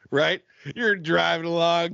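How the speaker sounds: ordinary delivery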